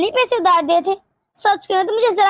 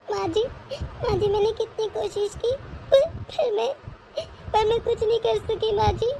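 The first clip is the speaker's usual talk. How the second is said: tearful; 9 dB quieter than usual. Acted. Grieving mother tells mother-in-law.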